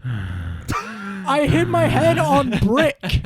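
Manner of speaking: slow, dazed pained moan; dazed groaning voice. Retold or imitated. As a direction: drag it out like you're hurt. Imitating injured friend's groan.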